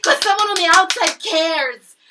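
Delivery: loud, slow, raging yell; furious shout. Real, not acted. To millionaire viewers, furious shouted demand. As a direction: scream it at the rich.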